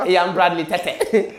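slow, laughing retold line; laughing through words. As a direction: say it while cracking up. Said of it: "I used to say".

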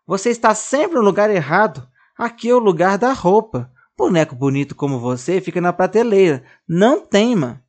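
high, loud scolding of a naughty doll. Acted. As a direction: tell him off like a strict little mother.

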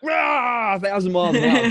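hammed-up rushing yell; mock frantic hype. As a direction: shout it like you're racing flat out. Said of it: "That's how I am".

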